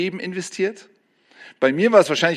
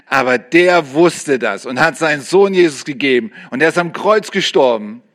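ordinary talk, nothing marked

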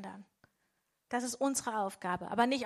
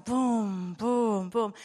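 slow heartbeat; 2 semitones lower, much slower than usual. Shown as a demonstration. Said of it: "sondern es wird langsamer".

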